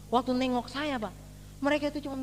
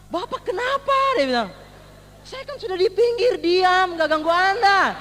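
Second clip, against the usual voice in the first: high, loud angry driver confronting; an angry driver shouting at the man who hit his bus; 6 semitones higher, 7 dB louder, slower than usual. Retold or imitated. Sopir bis marah setelah ditabrak. (sopir bis marah berteriak keras)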